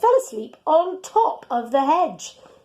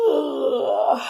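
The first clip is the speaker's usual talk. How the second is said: fake yawn; 3 semitones higher than usual. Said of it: "went Dog".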